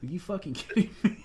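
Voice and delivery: high, incredulous, breaking into a laugh; laughing disbelief. Real, not acted. Soldier scoffing at tantrums in the military, laughing disbelief.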